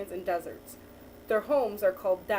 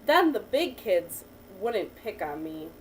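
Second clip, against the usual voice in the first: put-on reading to a child; 3 semitones lower than usual. Teacher reading a picture book to a child, lively storytelling. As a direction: read the picture book to the child, lifting each new animal.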